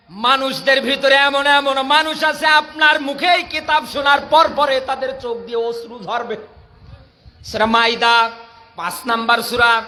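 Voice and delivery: ordinary talk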